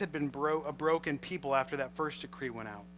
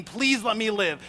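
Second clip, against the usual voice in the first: high, loud desperate plea; pleading Esther; 5 semitones higher, 7 dB louder than usual. Retold or imitated. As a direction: beg for your life. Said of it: "Esther is there pleading".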